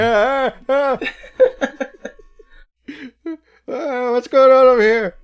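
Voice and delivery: high, loud panicky bug squeal. Pretend. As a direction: squeal like a frantic bug-eyed insect. Voicing the big-eyed bugs.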